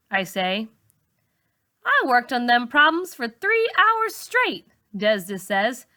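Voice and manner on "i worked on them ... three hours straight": put-on higher, indignant girl protesting. A narrator's reading. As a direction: insist you really tried. Desda protesting.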